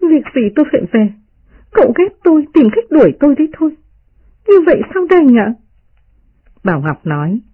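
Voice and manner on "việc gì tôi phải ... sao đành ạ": high-pitched, hurt maid protesting; understated tearful reproach, pitched well up. A narrator's reading. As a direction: plead in a high, wounded voice. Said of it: "rơm rớm nước mắt".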